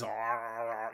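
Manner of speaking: low, soft mock beast growling; low quiet growls. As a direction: growl softly like a feeding werewolf. Werewolf eating lamb guts.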